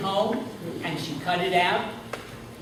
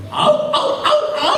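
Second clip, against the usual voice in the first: very high, loud howling; dog howl; 6 dB louder than usual. Acted. The dog singing to Mariposa, howling. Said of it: "Señor Perro sang like this".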